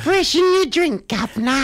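hammed-up servant offering a drink; posh butler. To his co-host, a mock posh butler offering drinks.

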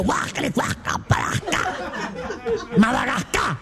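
unvoiced retching noises turned into words; a man sick at the pudding, gagging in mock disgust. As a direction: gag your way through it.

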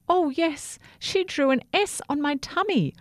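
high, eager character voice; eager little toothbrush. Acted. Toothbrush eagerly answers about Sophia.

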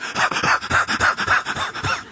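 voiceless panting cackle, in rapid bursts; rapid comic huffing laugh; mock frenzy noise. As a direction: imitate crazed prophets with breathy staccato noise. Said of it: "they're all like".

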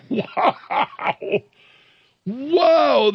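stunned disbelief. Real, not acted. Host reacting to the congressional candidate's cursing, stunned and incredulous. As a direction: gasp it out, then cry it out in disbelief.

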